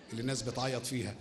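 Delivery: ordinary talk, nothing marked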